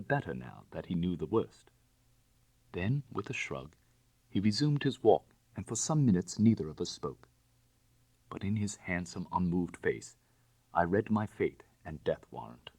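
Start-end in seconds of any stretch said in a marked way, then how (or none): none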